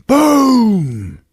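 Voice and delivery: loud, drawn-out "boom"; imitated explosion. Comic voicing the blow-up, loud explosion noise. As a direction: blast it out high, then let it fall.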